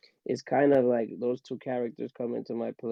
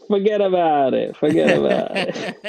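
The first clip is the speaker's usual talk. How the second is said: loud hammed-up New York accent, De Niro style; Robert De Niro New York accent; 11 dB louder than usual. Imagining the dog's first words, loud mock New York accent. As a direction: belt it like a tough Brooklyn De Niro, breaking into a laugh. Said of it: "Robert De Niro with a hardcore New York accent".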